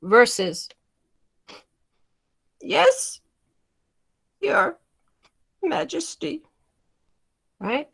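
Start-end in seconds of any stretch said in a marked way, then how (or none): 2.6-6.4 high, slow, drawn-out courtly reply. Shown as a demonstration. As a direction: stretch it out like a humble servant. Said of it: "It's slower, it's drawn out a bit".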